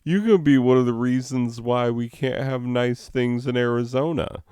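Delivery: lightly acted dismayed telling-off; mock scolding. Teacher's imagined retort to a defiant student, mock scolding.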